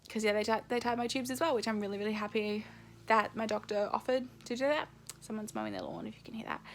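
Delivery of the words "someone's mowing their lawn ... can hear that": soft unvoiced whisper; hushed whisper about the lawnmower; whispered aside. Real, not acted. Aside about background noise.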